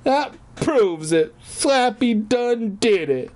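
put-on high, slow girlish insisting; whiny girl voice. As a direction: play the pouty kid who's sure she's right. Girl insists dummy did it.

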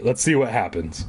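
usual speaking voice

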